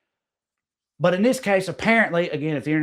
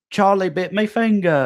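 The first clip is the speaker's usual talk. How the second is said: put-on quoting British baby; 7 semitones higher, 3 dB louder than usual. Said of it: "one of the earliest super viral videos was".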